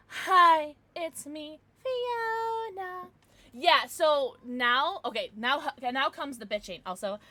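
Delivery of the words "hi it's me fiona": high, sing-song "it's me" voice; a playful greeting in a put-on high voice; character greeting. Voicing a character's self-introduction.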